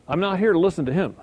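ordinary talk

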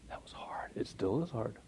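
hushed whispered admission; soft whisper of confession. Real, not acted. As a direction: confide it under your breath. Quiet confession.

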